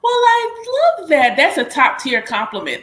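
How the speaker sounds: high, loud burst of delight; thrilled squeal easing down into praise. Real, not acted. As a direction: gush over a compliment, starting very high. Delighted reaction to a compliment.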